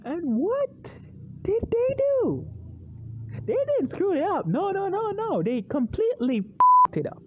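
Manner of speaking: high-pitched, exasperated disbelief; worked-up rant, voice leaping very high; incredulous outrage. Acted. Vlogger ranting about a ruined sequel, incredulous outrage.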